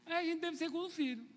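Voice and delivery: normal talking voice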